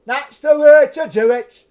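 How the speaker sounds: high, loud mock Punch heckle; heckling shout. Drunk heckler shouting at the puppet show, loud and high. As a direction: bellow it from the front row. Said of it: "and all that sort of malarkey".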